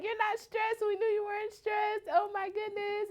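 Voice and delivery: very high-pitched mock squeal; excited coworkers. Coworkers teasing her about being stressed, squealing excitedly. Coworkers reacting to pregnancy news.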